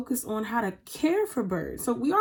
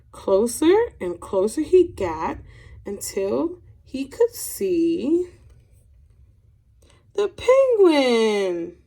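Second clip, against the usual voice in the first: suspenseful slowdown; 3 dB louder, much slower than usual. Acted. Suspenseful reveal in the story.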